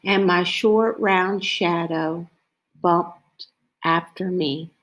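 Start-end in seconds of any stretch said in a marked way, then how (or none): none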